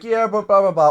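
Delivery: high mocking blah blah; dismissive mock voice; mocking friend. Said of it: "he's like".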